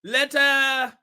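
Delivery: measured imitation of Shaba's accent, word by word. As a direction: declare it emphatically in Shaba's accent. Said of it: "keep this accent all night".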